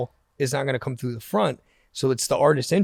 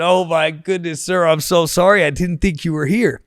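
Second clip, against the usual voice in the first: flustered apology; 5 semitones higher, 7 dB louder than usual. Retold or imitated. Apologising in panic to [name].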